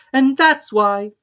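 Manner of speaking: high, slow, imperious lady; grand and dismissive; Duchess. A narrator's reading. Duchess figure voice.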